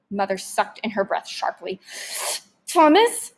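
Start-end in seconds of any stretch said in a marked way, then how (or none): 2.7-3.3 high, loud cry of his name; alarmed protest. A narrator's reading. Mother objecting to rifle, high loud alarmed call. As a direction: stop him with one sharp word.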